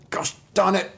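comic frustrated villain; frustrated wolf. Acted. Wolf's comic curse.

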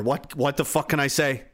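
ordinary talk

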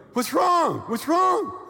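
high, loud imitation of startled friends; mock baffled shout. Acts couple reacting to wife crying.

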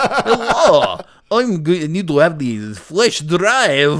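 loud, hammed-up Russian accent; fake Russian accent. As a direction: imitate a bad movie Russian accent. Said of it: "doing the fake Russian accent".

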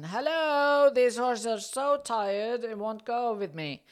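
loud high call to someone far off; retold shout. Shouting across to Fabio about the tired horse, loud and high. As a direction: call out hard, easing down. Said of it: "I shout out again".

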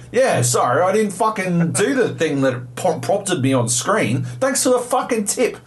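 high-pitched, scornful mock thanks; sarcastic apology. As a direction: lay the sarcasm on thick. Sarcastic thanks for useless advice.

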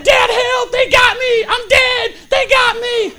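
put-on high, loud panicked kid's scream; screaming for help. Boy caught from behind calls his dad, screaming. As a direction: shriek for rescue, reenacting childhood terror. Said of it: "I'm screaming for my dad".

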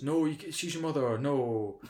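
high, loud, frantic begging; pleading shout. Retold or imitated. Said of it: "Trish gets in the way and goes".

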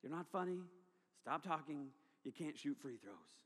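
low, soft heckle; annoyed. Retold or imitated. Bored camper heckling the man on stage, quietly annoyed. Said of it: "I was annoyed".

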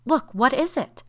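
high, loud cry of alarm; alarmed demand. A narrator's reading. Woman calls others to the dreadful shadow, alarmed. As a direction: cry it out, startled.

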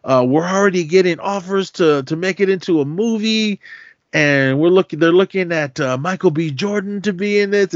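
high, gushing sales pitch; eager publishers. Retold or imitated. Publishers pitch the movie deal to Monk, high and eager. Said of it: "they were all for it".

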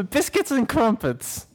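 high, giggly mock British accent; cartoonish high-pitched fake British; horrible British accent. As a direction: ham up a silly British accent. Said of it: "these horrible accents".

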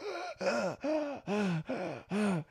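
series of high, heaving gasps; acted gasping for air. Storyteller reenacting finally breathing, exaggerated gasps. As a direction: gasp repeatedly as if air finally returns. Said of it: "I could finally fucking breathe".

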